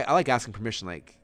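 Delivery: ordinary talk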